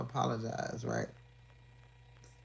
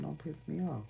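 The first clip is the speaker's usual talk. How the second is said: calm warning; 3 semitones higher, 5 dB quieter than usual. Retold or imitated. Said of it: "I said".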